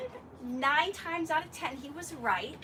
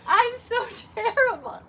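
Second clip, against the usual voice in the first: higher, louder hammed-up wailing; mock despair; 9 semitones higher, 11 dB louder than usual. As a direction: wail it like a crushed artist, half laughing. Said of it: "And I was crushed".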